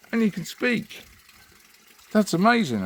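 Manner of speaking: very high squeaky astonishment; overwhelmed, voice shooting way up; astounded barman. Acted. Barman in joke astonished again.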